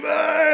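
loud, high "aah" of effort; mock straining yell. Five-year-old fighting what he thinks is huge, straining yell. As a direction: imitate a kid yelling as he hauls. Imitating son straining with rod.

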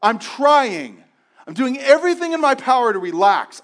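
loud, high exasperated reply; an anxious person answering a friend, frustrated. Acted. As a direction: insist you're doing everything you can. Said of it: "the most frustrating thing".

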